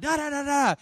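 high-pitched babble of nonsense syllables; rapid-fire "da da" jabber; excited mock chatter. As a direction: imitate animated phone chatter up high. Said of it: "They're excited".

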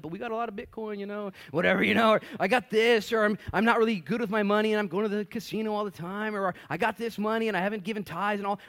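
high, rising rambling excuses; mock shady believer. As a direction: ramble nervously through excuses.

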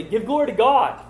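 high, loud commanding demand; demanding Pharisees. Retold or imitated. Pharisees to the healed man, a loud high demand. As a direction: Pharisees order him to give God the glory.

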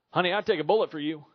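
loud, quick mock-heroic promise to a wife; boastful husband. Acted husband's brave boast to wife.

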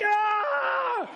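high, shouted mock scream. Father being climbed on by his kids on the ride: a high shouted yelp. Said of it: "so I'm like".

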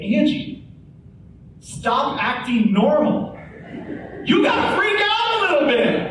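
laughing mock yell. Husband urging calm nurse wife to panic, laughing mock yell. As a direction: laugh while pretending to panic at her. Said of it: "I almost wanted to be like".

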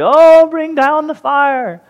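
high, loud, slow mock wail; drawn-out mocking wail of Baal's prophets. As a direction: ham up a desperate plea to the sky. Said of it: "as they wail and say".

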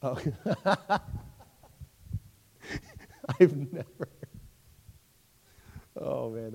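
real helpless laughter between broken words; cracking up. Real, not acted. Teacher reacts to an unexpected answer about Nero, really cracking up. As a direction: try to talk while laughing.

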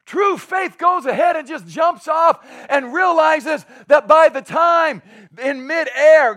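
loud, high preacher's cry with stretched words; rousing, drawn-out sermon delivery; preaching at full force. Real, not acted. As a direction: preach it slow and big, pitched high.

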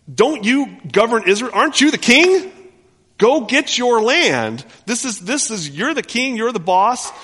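mocking, high and loud, goading the king; wife mocks husband into grabbing the land, sarcastic; sarcastic taunt. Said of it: "hear the sarcasm".

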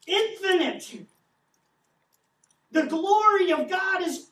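loud, high, fervent sermon; thundering pulpit delivery; impassioned preaching. Acted. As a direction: preach it with full fire. Preaching at full force.

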